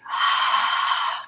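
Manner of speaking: open-mouthed breathy "haah"; constricted-throat exhale. Shown as a demonstration. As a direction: exhale as if fogging up a mirror. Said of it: "made by constricting the throat".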